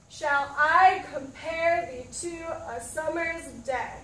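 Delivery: slow, with every second syllable hit; iambic reading. Shown as a demonstration. Teacher reads Shakespeare aloud to class, slow beat stressed. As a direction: read it slowly so the da-DUM beat shows. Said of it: "let's try and read this out loud".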